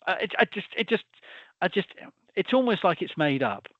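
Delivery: ordinary talk, nothing marked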